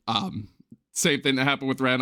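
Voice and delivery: normal talking voice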